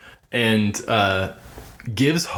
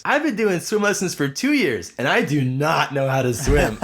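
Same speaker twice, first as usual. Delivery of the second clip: put-on much higher kid voice, cracking into laughter; 5 semitones higher than usual. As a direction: confess it proudly like a clueless kid. Kid confessing he can't swim.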